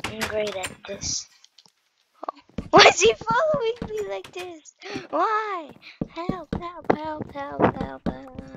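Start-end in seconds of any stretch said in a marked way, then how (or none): 2.7-5.7 high panicked cry; panicking gamer. Real, not acted. Being chased in game. 6.1-8.4 high repeated cries for help; panicking gamer. Real, not acted. Being chased in game.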